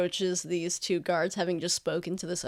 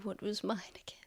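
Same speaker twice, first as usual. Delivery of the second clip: whispered aside, unvoiced; 13 dB quieter, faster than usual. Real, not acted. Asking a friend at the table in a whisper. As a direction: breathe it so the table can't hear. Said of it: "whispering at the table".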